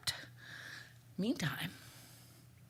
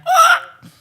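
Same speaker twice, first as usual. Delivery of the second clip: high, loud bird screech; bird shriek; 15 dB louder than usual. Acted. The bird crying out in triumph, high loud screech.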